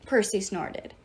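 ordinary delivery

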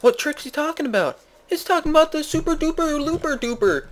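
high, hammed-up cartoon voice; alarmed onlooker. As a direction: squeal it in mock alarm. Alarmed onlookers in improvised story.

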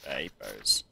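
very low, soft put-on deep voice. Gamer voicing the checkpoint inspector, very deep. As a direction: rumble it low from the chest. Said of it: "I'll just do a deep voice".

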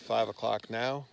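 ordinary talk, nothing marked

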